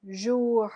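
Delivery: Jour, rounded oo, French style; French word jour. Shown as a demonstration. Teacher models jour for students to repeat. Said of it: "Our next word is jour".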